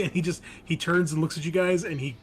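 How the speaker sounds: usual speaking voice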